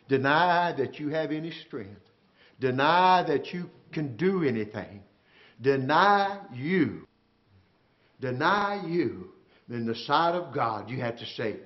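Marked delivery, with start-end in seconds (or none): 5.6-9.3 very slow, each word weighted; slow emphatic. Acted. Preacher driving home denying yourself, slow and weighted. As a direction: lay down each word deliberately.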